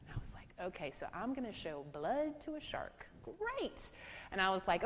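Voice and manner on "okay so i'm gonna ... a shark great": sarcastic aside. Retold or imitated. Sarcastic about supervisor's advice.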